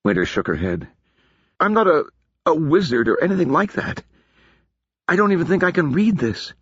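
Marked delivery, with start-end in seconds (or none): 1.6-6.5 put-on high, anxious young woman; protesting she is no wizard; lightly voiced worried girl; Winter's voice.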